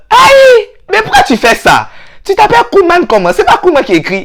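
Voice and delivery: shrill aïe, loud and high, then scolding; mock outrage. Friend mocking someone who frenchified his name Kouman. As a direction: shriek at the fake name.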